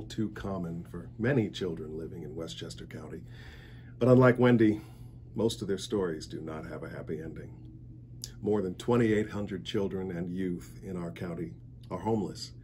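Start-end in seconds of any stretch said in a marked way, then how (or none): none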